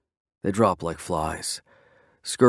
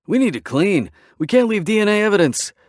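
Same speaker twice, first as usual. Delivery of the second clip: higher voice; 12 semitones higher, 5 dB louder than usual. A narrator's reading. Knives voiced as another character.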